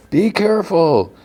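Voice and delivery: high, held-back holler; mock called warning. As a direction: pretend to call a warning from afar. Mark calls down a warning.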